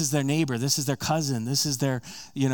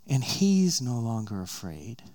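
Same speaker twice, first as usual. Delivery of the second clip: ordinary talk, nothing marked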